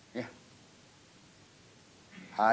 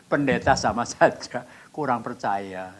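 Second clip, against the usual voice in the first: slow, louder drawn-out taunt; mengejek diri; 5 dB louder, slower than usual. Retold or imitated. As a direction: stretch it out as a mocking reproach. Menegur diri dengan nada mengejek. (ejekan yang dipanjangkan dan agak keras)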